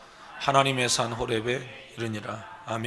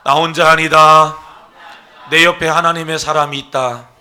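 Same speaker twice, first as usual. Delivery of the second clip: loud bold proclamation; loud declaration; 4 semitones higher, 17 dB louder than usual. Shown as a demonstration. Pastor leading the church in repeating, loud declaration. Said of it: "따로 사겠습니다".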